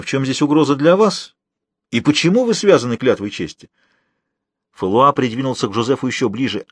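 ordinary delivery, nothing marked

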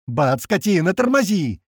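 urgent order climbing high; angry yell. A narrator's reading. Man demanding the pilot brake, angry yell. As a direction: yell at the driver with rising pitch. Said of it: "орёт Рыжий".

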